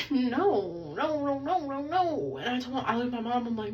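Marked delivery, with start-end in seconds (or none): 0.1-2.4 whimpering "no" with a crying catch, pitched up; mock crying. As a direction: act out the breakdown, half-sobbing. Retells breaking down crying.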